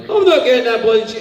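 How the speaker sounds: loud, high Scottish outburst; Scottish accent. Acted. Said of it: "I don't have a Scottish accent".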